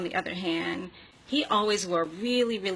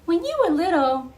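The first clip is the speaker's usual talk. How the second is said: put-on higher, louder child voice with a laugh breaking in; little boy voice; 11 semitones higher, 5 dB louder than usual. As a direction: voice a curious kid asking Michael. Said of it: "and the little boy said to Michael".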